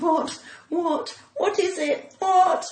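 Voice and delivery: startled tourist facing a pointed shotgun, panicked; alarmed. Retold or imitated.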